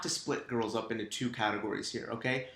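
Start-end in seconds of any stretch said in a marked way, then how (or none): none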